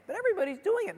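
high, whiny protest; mocking high-pitched objection; mimicked excuse. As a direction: pipe it up like a pleading teenager.

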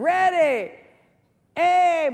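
high, loud "Ready, aim" called out; captain's command shout. Acted. Joke captain commanding the firing squad, loud shout.

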